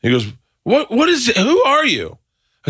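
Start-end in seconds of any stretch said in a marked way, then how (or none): 0.6-2.2 high, spluttering mock outrage; retold outrage. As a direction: imitate a flustered, furious booker. Retold booker enraged on phone.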